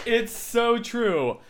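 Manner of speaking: drawn-out agreement. Real, not acted. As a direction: stretch it out, deeply agreeing.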